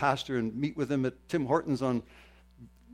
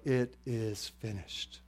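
soft, slow restatement; hushed quote; 3 semitones lower, 10 dB quieter, slower than usual. Retold or imitated. Preacher recalling the three words screamed out, now hushed. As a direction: say it slowly and softly, letting it settle.

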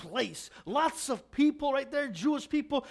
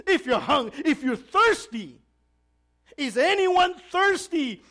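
raised, drawn-out calling; loud voice; 4 semitones higher, 6 dB louder, slower than usual. Retold or imitated. Preacher voicing Jesus calling out at the feast, loud. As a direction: call out over a crowd, getting higher and louder. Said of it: "Jesus calls out in a loud voice".

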